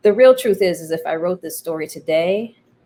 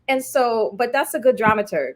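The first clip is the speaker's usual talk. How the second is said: ordinary delivery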